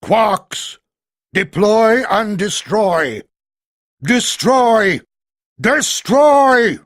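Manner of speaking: loud, slow, building roar; bellowed command. A narrator's reading. Giant voice unleashing the Quarks, loud building roar. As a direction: roar the attack order, rising. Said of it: "the giant voice bellowed".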